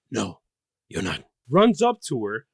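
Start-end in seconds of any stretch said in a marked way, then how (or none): 0.1-1.2 low, soft gravel-free mock Batman; lightly voiced Batman growl. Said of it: "Batman voice".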